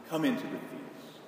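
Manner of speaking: soft, pleading invitation; gentle pleading. Retold or imitated. Father softly pleads with his sulking son to come in. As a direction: quietly coax him in. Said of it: "comes out and pleads".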